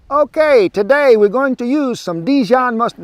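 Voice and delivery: hammy fake Italian accent, high and loud; mock Italian accent. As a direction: playfully ham up a fake Italian chef. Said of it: "Wrong accent".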